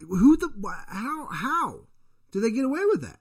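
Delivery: can't believe anyone got away with it; shocked disbelief. Real, not acted. Telling his cohost about the experiment, stunned and indignant. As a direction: pitch jumps way up in outraged disbelief.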